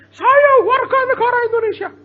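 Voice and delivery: mock outraged yell, very high and loud; angry ranting. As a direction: imitate a furious woman's high-pitched protest. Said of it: "Dia marah-marah dengan bahasa Indonesia".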